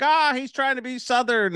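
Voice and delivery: high-pitched imitation of grumbling Canadians; mock Canadian complaint. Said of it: "the Canadians are like".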